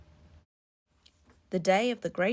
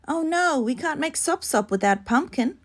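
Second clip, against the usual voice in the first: dismayed cry; 8 semitones higher than usual. Acted. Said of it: "cried Akka".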